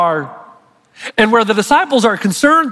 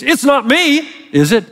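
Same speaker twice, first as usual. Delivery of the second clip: ambivalent denial; 6 semitones higher than usual. Shown as a demonstration. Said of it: "So it's like saying this".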